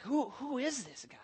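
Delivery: awed whispered question. Retold or imitated. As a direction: whisper it in disbelief. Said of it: "We're like".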